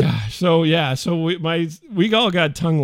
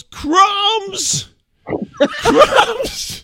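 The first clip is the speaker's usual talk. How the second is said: high shrill-free mock yell of crumbs, much higher; wife's outraged yell, pitched way up; 6 dB louder than usual. Wife finding an empty chip bag, yelling. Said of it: "she yells".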